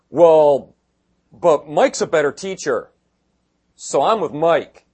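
loud, slow, petty one-upmanship; mock bickering. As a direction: sound like a proud squabbling churchgoer. Mocking congregants arguing over pastors.

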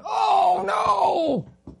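high, loud mock wail of despair. Pastor mimicking believers crying out to God, hammed-up high wail.

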